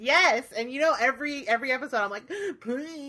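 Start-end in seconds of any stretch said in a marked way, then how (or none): 2.4-3.1 higher, playful plea; mock begging. Podcast host begging listeners for iTunes reviews, mock pleading. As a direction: imitate your own begging for reviews. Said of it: "begging for iTunes reviews".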